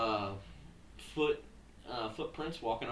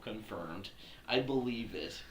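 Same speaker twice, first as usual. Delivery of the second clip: ordinary talk, nothing marked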